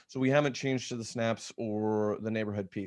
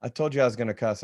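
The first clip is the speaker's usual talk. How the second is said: normal talking voice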